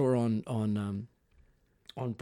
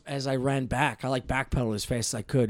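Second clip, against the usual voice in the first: usual speaking voice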